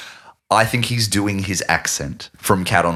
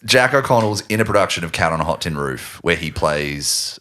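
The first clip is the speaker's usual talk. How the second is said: ordinary talk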